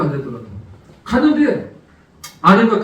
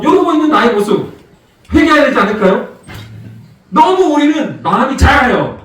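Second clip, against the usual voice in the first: loud, rising sermon exhortation; full-force preaching; 5 semitones higher, 5 dB louder than usual. Acted. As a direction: drive the congregation with building fervor. Preaching climax.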